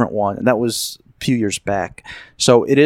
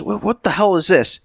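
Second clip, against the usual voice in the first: retold indignant yell, pitched up; indignant outburst; 8 semitones higher than usual. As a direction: blurt it out, shocked and a bit hammed-up. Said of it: "And he says".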